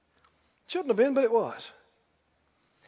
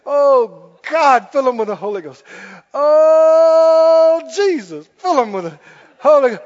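louder, straining cry to God, pleading hard; loud pleading prayer; 5 semitones higher, 10 dB louder, slower than usual. Retold or imitated. Preacher retelling how he begged God to fill them, loud pleading. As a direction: call out to God as if pushing the Spirit in.

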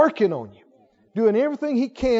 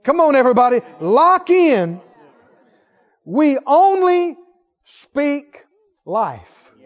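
loud, higher call to the crowd; rallying shout; 5 semitones higher, 8 dB louder, slower than usual. Real, not acted. Preacher urging everybody to lock in, loud rallying call. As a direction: rouse the whole room.

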